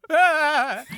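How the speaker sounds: sharp high loud yelp of fright; startled scream. Acted. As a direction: blurt a sudden scared scream. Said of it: "Vincent's like".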